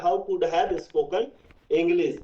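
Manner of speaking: very slow, word by word English question; slow model sentence. Shown as a demonstration. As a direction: model the question slowly for the class. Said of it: "पूछ के देखो बेटा". (बहुत धीरे, एक-एक शब्द साफ़ बोलना)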